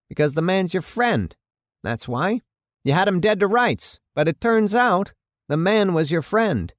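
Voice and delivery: high-pitched, pointed accusation from the mayor; accusing mayor. Acted. Mayor accusing the chief of freeing his friend, indignant.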